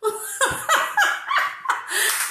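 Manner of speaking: high squealing laugh. Real, not acted. Laughing at herself.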